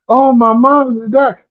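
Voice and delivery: mildly hammed-up woe; mock fake cry. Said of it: "start doing a fake cry".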